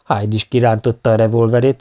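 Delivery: plain reading voice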